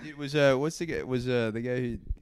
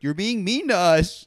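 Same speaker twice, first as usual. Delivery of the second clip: loud, high, drawn-out whine of protest; mock complaint; 6 semitones higher, 9 dB louder, slower than usual. Everybody telling the alpha guy off, mock complaint. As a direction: imitate a wounded crowd objecting loudly. Acts out audience complaint to comic.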